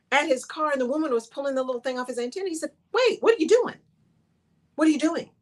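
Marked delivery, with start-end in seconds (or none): 2.9-5.3 startled demand. Retold or imitated. Cousin confronts woman at car.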